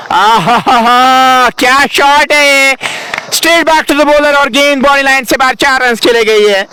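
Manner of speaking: high, drawn-out boundary call; excited cricket commentary. Real, not acted. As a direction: burst out as the ball races away. Boundary hit, commentator erupts.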